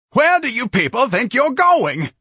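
very high, loud, slow yell; outraged cry. Acted. Ignatius to his battalion filing out, outraged yelling. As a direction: yell it after them in outrage.

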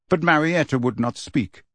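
ordinary delivery, nothing marked

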